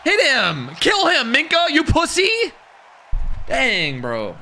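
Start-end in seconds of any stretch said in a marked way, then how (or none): 0.0-2.3 hyped yelling. Real, not acted. Yelling at the game.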